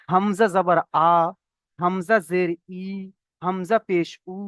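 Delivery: "আ", "ই", "উ" as held aa, ee, oo; name each mark, then hold "আ", "ই", "উ"; spelling-out drill. Shown as a demonstration. Teacher showing hamza readings, "আ", "ই", "উ" held as aa, ee, oo. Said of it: "এমন ভাবে পড়তে হবে".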